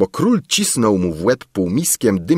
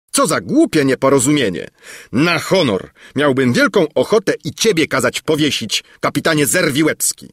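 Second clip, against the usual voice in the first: higher, emphatic royal reproach; annoyed threat; 7 semitones higher, 3 dB louder than usual. A narrator's reading. King threatening to hang the captain too, annoyed. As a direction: berate the captain with exasperation.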